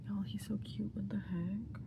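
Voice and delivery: breathless whisper of delight; whispered gushing. Real, not acted. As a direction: whisper it as if overwhelmed. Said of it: "whispered fangirling".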